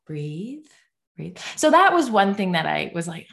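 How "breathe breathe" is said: soft, hushed coaching to oneself; retold attempt to calm down, quietly; self-soothing. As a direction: let it out softly like a calming breath. Acting out calming herself at work.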